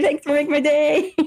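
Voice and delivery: laughing through a sarcastic thank-you. Real, not acted. Retelling the age mix-up to the co-host, laughing sarcastically. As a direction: crack up while thanking her.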